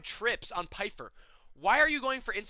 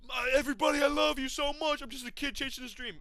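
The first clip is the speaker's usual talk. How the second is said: high-pitched, put-on sappy voice; mock gushing fighter; 6 semitones higher than usual. Commentator mocking a winner thanking his fans, gushing. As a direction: imitate an over-earnest fighter, pitched up.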